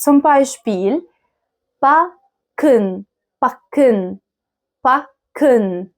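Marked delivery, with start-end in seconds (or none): 1.9-5.9 Backen with a held, stressed K; doubled k. Shown as a demonstration. Teacher modelling ck for learners, doubled K in Backen. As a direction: split Backen as "bak-ken", leaning on the K. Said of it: "كاف كده مشددة شوية".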